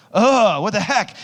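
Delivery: high exasperated groan into a protest. Acted. Awkward kid baffled by himself, exasperated. Acts out his frustrated younger self.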